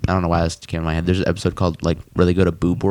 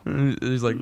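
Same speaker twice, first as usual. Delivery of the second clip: ordinary talk, nothing marked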